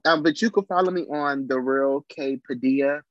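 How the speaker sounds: usual speaking voice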